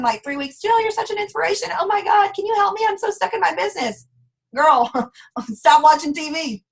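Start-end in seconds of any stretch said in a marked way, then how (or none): 0.6-4.1 much higher whiny pleading voice; mock desperate plea. A stuck seller begging Jill for help, high and whiny. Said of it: "a crazy message from them".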